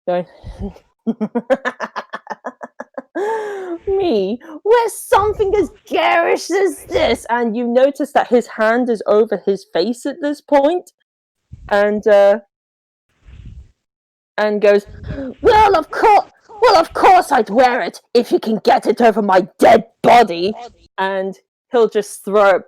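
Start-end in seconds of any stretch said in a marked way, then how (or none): 1.1-3.8 high-pitched snickering laugh; high snigger. Acted. 3.9-7.3 high, sneering character voice; mocking high voice. Character mocks garish shirt. 15.4-20.5 loud, high sarcastic voice; loud sarcastic refusal. Acted.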